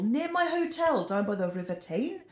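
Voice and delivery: high, lilting Geordie put-on; badly faked Newcastle accent, swooping upwards. Vlogger recalling her Newcastle trip, bad Geordie accent. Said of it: "That's a really bad accent".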